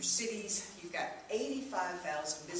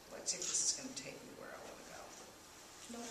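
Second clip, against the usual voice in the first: fast, softer whisper to herself; whispered aside; 8 dB quieter, much faster than usual. Real, not acted. Speaker checking her slide clicker, whispered aside. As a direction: mutter it under your breath.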